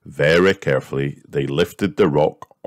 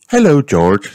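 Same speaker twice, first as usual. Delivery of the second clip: high, louder cheerful mum voice; Mummy Pig greeting; 10 semitones higher, 8 dB louder than usual. A narrator's reading. Mummy Pig greets George, high and louder. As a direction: greet the little boy as his mother. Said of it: "said Mummy Pig".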